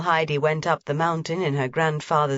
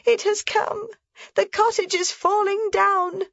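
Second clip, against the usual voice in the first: put-on much higher, frightened old woman; frightened cry. A narrator's reading. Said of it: "she cried".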